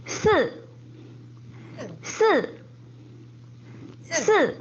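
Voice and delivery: Si falling fast from high; 四 fourth tone, steep fall. Shown as a demonstration. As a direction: drop Si sharply down. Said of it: "si is fourth tone".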